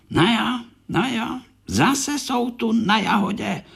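a touch of irritation, pitched high; cross Korálek. Acted. Korálek scolding the fruit flies, mildly cross and high. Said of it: "rozezlil se Korálek".

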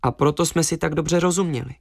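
plain reading voice

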